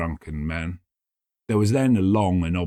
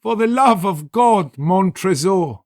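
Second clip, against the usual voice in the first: high, loud, slow desperate cry; desperate plea; 11 semitones higher, 7 dB louder, slower than usual. Acted. Fortunato begging Montresor for mercy, desperate cry. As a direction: beg for your life.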